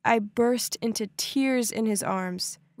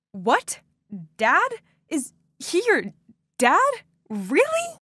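broken into disbelieving bursts, each shooting upward; stunned stammer; 6 semitones higher, slower than usual. A narrator's reading. Daughter learning her rival calls him dad, stunned stammer. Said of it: "I stammered".

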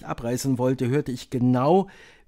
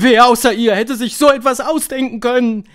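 high, very loud adoring cry; high shout of admiration; crowd shouting; 11 semitones higher, 11 dB louder than usual. A narrator's reading. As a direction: shout out in awe like a whole audience. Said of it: "schrie das Publikum".

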